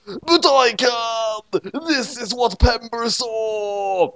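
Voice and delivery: high, slow dramatic announcement; grand announcer voice. A narrator's reading. Narrator unveils the future vision, high slow announcement. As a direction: boom it out like a trailer.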